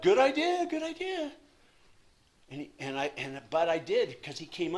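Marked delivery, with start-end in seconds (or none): 0.0-0.7 whiny voice. Retold or imitated. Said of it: "It's really good". 0.7-1.3 whiny voice. Retold or imitated. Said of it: "It's really good".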